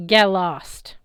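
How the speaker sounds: mild gruff send-off; curt dismissal. A narrator's reading. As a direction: shove him away with words. Ares dismissing Percy.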